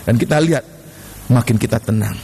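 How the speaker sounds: low, measured, spaced-out phrases; calm. Real, not acted. Preacher showing calm prayer after panic, low and calm. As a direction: settle the voice down, pausing between phrases.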